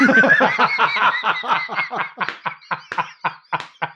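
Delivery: high, long, real laughing fit; helpless laughter. Real, not acted. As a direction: crack up at your own punchline. Laughs at own story's punchline.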